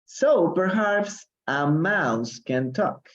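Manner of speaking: slow storybook read; slow, careful story reading; reading aloud. Acted. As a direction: read it slowly for the class.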